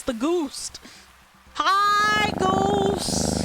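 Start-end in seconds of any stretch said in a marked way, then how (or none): 1.6-3.4 high, loud excited hello; excited greeting. Real, not acted. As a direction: greet it with sudden delight. Greeting the summoned goose.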